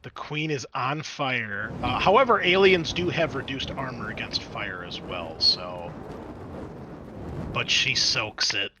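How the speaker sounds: ordinary talk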